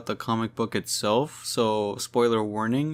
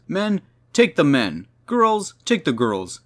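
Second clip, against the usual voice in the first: high, loud villain commands; mock barked orders; 6 semitones higher, 5 dB louder than usual. Misogynistic villain directing the chase, mock barked orders. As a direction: call out orders to the henchmen.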